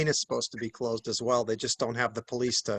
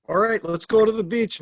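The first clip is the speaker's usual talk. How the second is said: higher, lightly voiced children; eager kids; 6 semitones higher, 4 dB louder than usual. Parent quoting kids in the car, lightly eager. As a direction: lightly imitate kids pitching an outing.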